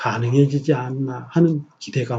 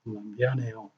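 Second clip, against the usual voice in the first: soft apology; 4 semitones lower, 15 dB quieter than usual. Retold or imitated. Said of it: "'죄송합니다'가 안 나올 거야".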